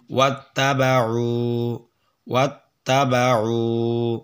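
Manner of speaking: "ওয়াত্তাবাউ" slow, final oo held; one-alif madd. Shown as a demonstration. Teacher modelling the word, "ওয়াত্তাবাউ" with lengthened ending. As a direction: draw out the last vowel of "ওয়াত্তাবাউ". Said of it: "এক আলিফ টান".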